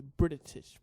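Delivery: "British" with a stuttered extra syllable, brit-it-ish; stumbled pronunciation. Real, not acted. Co-host fumbling the word British, tongue-tied. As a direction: trip over the middle of "British". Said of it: "I messed up saying British".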